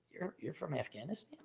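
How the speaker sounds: quietly asking the woman where she's from; hushed retelling. Retold or imitated. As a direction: ask it under your breath. Retold chat with Afghani woman.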